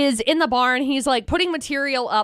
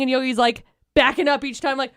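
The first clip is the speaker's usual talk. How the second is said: usual speaking voice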